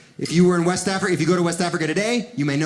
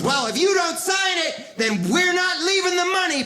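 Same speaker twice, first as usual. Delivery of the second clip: squeaky high lieutenant voice; 11 semitones higher than usual. Retold or imitated. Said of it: "the lieutenant goes".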